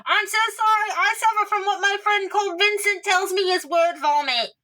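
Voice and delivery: high, rushed flustered apology. Acted. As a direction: gush the apology, embarrassed. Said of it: "But Ina goes".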